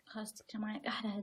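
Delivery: whispered aside. Real, not acted. Muttering while broke.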